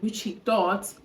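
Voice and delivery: ordinary delivery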